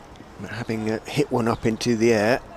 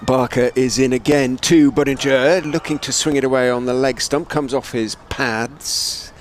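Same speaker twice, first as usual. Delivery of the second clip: louder, higher, projected ball-by-ball call; live cricket play-by-play; 4 semitones higher, 7 dB louder than usual. Real, not acted. Commentator calling Barker in to Budinger, raised live call. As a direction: lift the voice as the bowler runs in.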